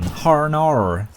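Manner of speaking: high, drawn-out "Harnar" with heavy American Rs; mock American accent. Shown as a demonstration. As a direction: stretch "Harnar", exaggerating the American R. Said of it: "as if in an American accent".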